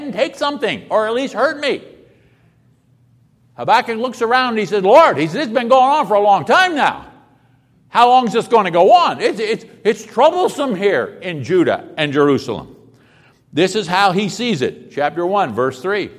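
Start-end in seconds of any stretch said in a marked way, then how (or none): 4.8-5.2 loud plea to the Lord; desperate cry of Lord. Acted. Prophet crying out to God. 5.3-12.8 raised, pleading voice; exasperated loud lament; loud complaint. Acted. Prophet complaining to God.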